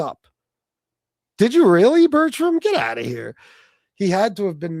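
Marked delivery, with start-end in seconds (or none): 1.4-3.4 high, loud disbelieving question; surprised. Real, not acted. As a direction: jump up in real surprise at Bertram. Reacting to co-host's remark.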